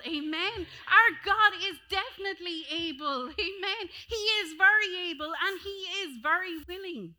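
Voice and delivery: loud, high rising declaration; shouted church proclamation; full-force preaching. Acted. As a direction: preach it out to the room.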